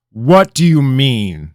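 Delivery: loud, high, slow menacing question; king questioning his advisor, dangerous; full of danger. A narrator's reading. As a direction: let the threat hang in it. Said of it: "asked in a tone full of danger".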